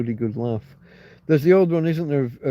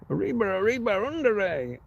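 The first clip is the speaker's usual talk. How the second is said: higher put-on cartoon mouse cry; higher cartoon-mouse voice shouting arriba; Speedy Gonzales; 6 semitones higher than usual. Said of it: "Speedy Gonzales".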